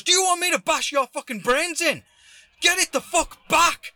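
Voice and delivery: high, loud threatening yell; imitated furious threat. Stranger threatening the delivery driver, imitated enraged shout. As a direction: roar it as the madder man. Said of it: "That's when he threatened me".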